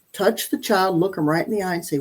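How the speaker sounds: ordinary delivery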